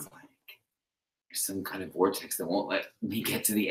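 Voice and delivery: breathy unvoiced whisper. Real, not acted. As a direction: whisper it, puzzled. Whispering about vortex.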